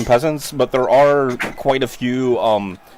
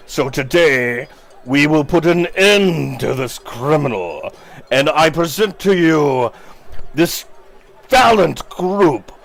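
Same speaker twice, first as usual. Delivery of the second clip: slow, higher, solemn town-square pronouncement; public proclamation; 3 semitones higher than usual. Acted. Mayor Vargas sentencing Bluto before the crowd, slow proclamation. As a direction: condemn the prisoner before the crowd. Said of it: "and he goes".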